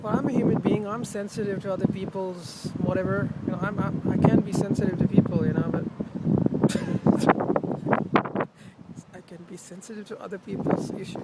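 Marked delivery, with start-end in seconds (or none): 5.9-9.1 breathy high giggle; a quick high laugh. Real, not acted. Laughs at own remark.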